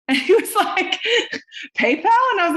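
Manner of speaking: high, laugh-choked retelling; words squeezed out through laughter. Real, not acted. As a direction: crack up while quoting someone. Laughing while retelling boyfriend's answer.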